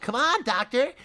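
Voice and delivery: high call over the shoulder; shouting. Acted. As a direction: call back cheerfully. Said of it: "he shouted over his shoulder".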